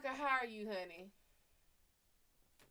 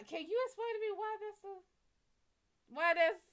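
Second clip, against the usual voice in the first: high-pitched, louder, rushing to ask why; baffled excitement; 11 semitones higher, 5 dB louder, faster than usual. Real, not acted. Asking the chat why they write ja ja, in high-pitched disbelief. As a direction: squeak out the question in amused disbelief.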